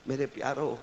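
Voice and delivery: ordinary talk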